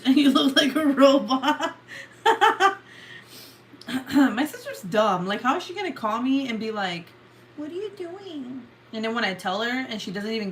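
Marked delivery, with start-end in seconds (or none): 0.0-1.8 loud, higher, breaking into laughter; laughing. Real, not acted. Teasing sister over the glitch, laughing loudly. 1.8-3.4 loud burst of high laughter; high loud cackle. Real, not acted. Laughing at sister's glitched look, high cackle. 7.6-8.6 high, soft mock-sister voice; imitating sister. Mocking sister's call for viewers, high soft mimicry.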